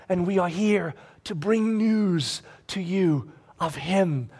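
slowing, sinking low and soft; hushed appeal. Acted. Paul pointing the crowd to God, hushed appeal. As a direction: settle into a near whisper by the end.

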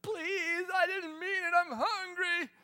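high, teary pleading; crying child. Retold or imitated. Hungry child to dad, high teary pleading. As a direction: beg through tears, hammed-up. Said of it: "after the child's cried".

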